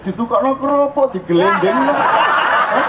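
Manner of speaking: high, giddy puzzled asking; high-pitched disbelieving "eh?"; mock bewilderment. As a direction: play it dazed and giggly at the windfall. Mock bafflement after the windfall.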